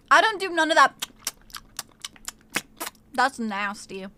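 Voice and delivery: usual speaking voice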